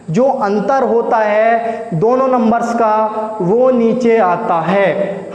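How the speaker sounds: slow, drawn-out, each word placed, with नीचे leaned on; slow emphatic repeat. Shown as a demonstration. Teacher drilling the rule to students, slow and emphatic. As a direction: spell the rule out word by word so it sinks in. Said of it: "teacher repeats the rule for emphasis".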